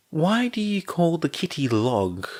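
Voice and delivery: put-on high-pitched child's question; curious little girl. A narrator's reading. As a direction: ask like an inquisitive small girl. Narrator voices curious young girl.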